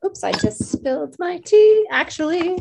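high, loud flustered outburst; startled oops. Real, not acted. Host spills tea mid-show, startled and flustered. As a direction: blurt it out, caught by a sudden mishap.

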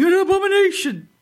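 high, loud mock-outraged yell; shouting. Host re-enacting own outburst at co-host, shouting. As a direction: burst out shouting, pitch shooting up. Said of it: "all of a sudden I'm shouting".

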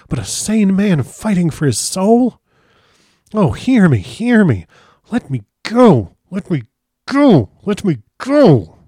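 high, loud, desperate begging; frantic pleading. A narrator's reading. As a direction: build to a wailing cry for release. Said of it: "he got into quite a frantic condition".

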